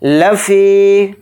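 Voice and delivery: लफ़ी with the फ़ी vowel drawn out; लफ़ी chanted slowly, long final ee. Shown as a demonstration. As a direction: stretch the ee of लफ़ी. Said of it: "फ़ी को यहाँ थोड़ा खींचेंगे".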